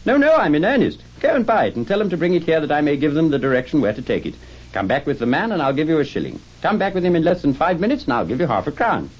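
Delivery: high, excited instructions; calling down urgently. Acted. Scrooge sending the boy to buy it, urgent excited call. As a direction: call the orders down with eager insistence.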